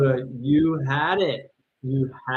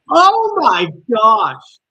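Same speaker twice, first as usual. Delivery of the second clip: slow, high, loud cry of shock; excited shout; 11 semitones higher, 12 dB louder, much slower than usual. Real, not acted. Streamer watching the game's final moments, loud stunned cry. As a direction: let it burst out, stunned by the play. Reacting live to the game.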